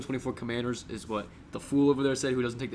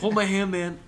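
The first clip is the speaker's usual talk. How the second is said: Bobby Kelly impression; 6 semitones higher, 4 dB louder than usual. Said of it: "this clip of, like, this one comedian, Bobby Kelly".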